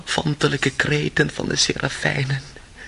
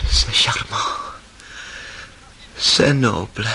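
delirious whisper; 5 semitones lower, 3 dB quieter than usual. Acted. Delirious Ivan mutters in French.

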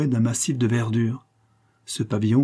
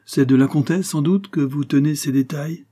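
raised, slightly louder teasing question; gentle mockery, pitched up; lightly mocking; 6 semitones higher, 4 dB louder than usual. A narrator's reading. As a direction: ask it with a touch of raillery. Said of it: "avec un léger accent de raillerie".

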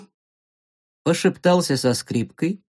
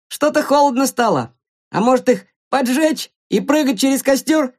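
higher-pitched playful suggestion; joking; 8 semitones higher than usual. Acted. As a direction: toss it out as a lively joke. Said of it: "пошутил третий". (шутливо, высоким голосом)

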